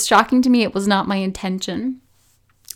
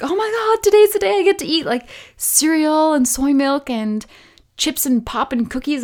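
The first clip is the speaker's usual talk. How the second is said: much higher, gushing and fast at first; giddy excitement; 7 semitones higher than usual. Retold or imitated. Waking on day thirty-one, squealing with giddy excitement. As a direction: squeal with joy at finally getting junk food. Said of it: "I was like".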